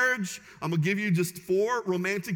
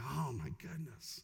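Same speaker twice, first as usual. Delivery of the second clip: awed hush; 4 semitones lower, 12 dB quieter, slower than usual. Retold or imitated. Pastor reacts to moving story.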